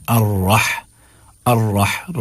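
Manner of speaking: "الرح" with thick rolled r and breathy throat h; heavy raa. Shown as a demonstration. Teacher drilling the syllable for learners, heavy raa. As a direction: make the r in "الرح" full and heavy. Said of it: "را کو موٹا کر کے".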